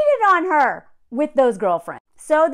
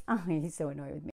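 softer, lower and fast; mock mumble; 11 semitones lower, 12 dB quieter, faster than usual. Imitating the girlfriend's complaint, quick and soft. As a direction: toss it off softly and quickly under the breath. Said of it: "So then they're like".

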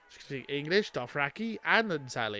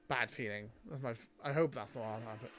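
ordinary talk, nothing marked

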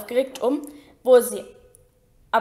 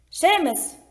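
called out; 5 semitones higher than usual. A narrator's reading. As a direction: summon the next pupil by name. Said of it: "rief Professor Lupin". (hoher, knapper Zuruf)

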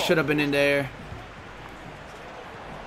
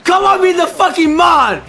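hype shout; 7 semitones higher, 4 dB louder than usual. Real, not acted. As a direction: roar it out after the goal. Goal celebration trash talk.

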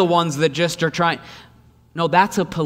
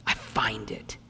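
hushed discovery; breathy secret whisper of discovery; 6 semitones lower, 11 dB quieter, slower than usual. Acted. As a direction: whisper it, softer, as a secret found. Quiet revelation in sermon.

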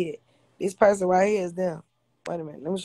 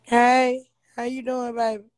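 excited greeting; 5 semitones higher, 5 dB louder, slower than usual. Real, not acted. Greeting a viewer on live.

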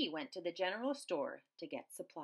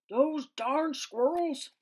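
put-on slow, high, loud old man's shout; angry yell; 8 semitones higher, 10 dB louder, slower than usual. A narrator's reading. Old man yelling at squirrels, slow furious shout. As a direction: shake your fist and holler it out slowly. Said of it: "he shook his old man fist and yelled".

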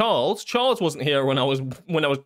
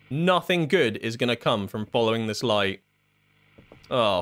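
ordinary talk, nothing marked

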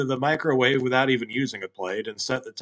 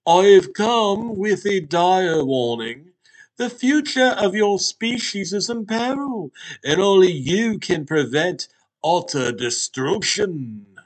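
grave talking Hot Pocket; 7 semitones higher, 6 dB louder than usual. Acted. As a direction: proclaim it like an ancient oracle. Hot Pocket delivers dire warning.

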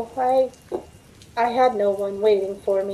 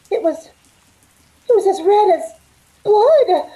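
higher, louder, voice climbing in shock; rising horror; 8 semitones higher, 5 dB louder than usual. Acted. Waitress sees blood on her hand, rising horror. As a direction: build from dread to alarm.

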